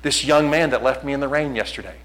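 normal talking voice

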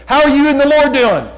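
high, booming question; hearty acted greeting. Acting out his greeting to people.

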